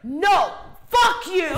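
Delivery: high, loud yell at the dog; angry yell. Retold or imitated. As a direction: shout the dog away. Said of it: "She's like".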